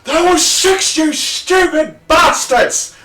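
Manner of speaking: high, loud raging shout; furious captain. Acted. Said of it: "He goes".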